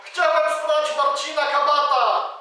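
loud, high forced confession; full-voice shout. Acted. Robber yelling the confession as Marcin orders, full shout. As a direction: shout it out for everyone to hear. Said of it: "krzyknij na cały głos".

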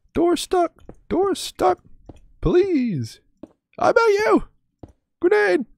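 very high squeaky falsetto pleading; door stuck meme voice. Pretend. Streamer quoting the door stuck meme, in a very high squeaky plea. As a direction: squeak it out like a desperate cartoon.

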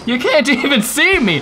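high-pitched crowing taunt; gleeful taunt. Acted. Player taunting unseen rivals, gleeful and high.

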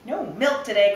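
loud, high, dismayed cry. Acted. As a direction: shout it out in dismay. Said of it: "cried Farmer Brown".

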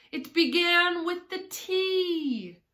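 high, loud, drawn-out wail; hammed-up desperate explanation; flustered Hatter. A narrator's reading. Flustered Hatter answers the king.

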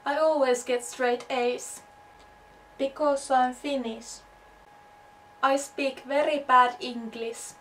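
normal talking voice

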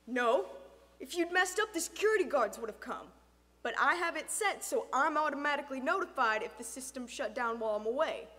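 loud, firm man's voice; stern father. Acted. Father correcting intruder about alarm, stern. Figure of Jen's father explaining.

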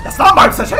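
loud, high-pitched alarm; startled shout. Real, not acted. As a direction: blurt it out in shock. Startled reaction in game.

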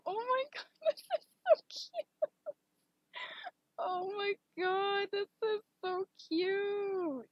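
high, slow gushing over how cute it is; squealing with delight. Real, not acted. Streamer squealing at a viewer's cute art, high and slow. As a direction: melt over the art, pitch way up.

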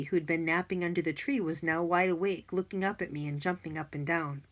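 plain reading voice

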